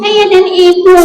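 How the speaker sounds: high-pitched echoed answer; puppet pupil. Acted. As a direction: parrot it back as a puppet.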